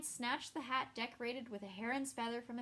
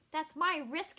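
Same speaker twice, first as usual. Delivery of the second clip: loud, high, furious outburst; enraged shout; 6 semitones higher, 6 dB louder, slower than usual. Acted. Yennefer to Geralt, refusing his warning, enraged shout. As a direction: throw it back at him in a rage. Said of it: "she shouted, enraged".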